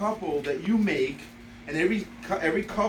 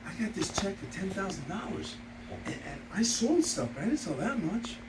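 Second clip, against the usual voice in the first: quiet, lightly worried retelling; a hint of a nervous caller confessing; retold worry; 6 dB quieter than usual. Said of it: "He has this worried sound in his voice".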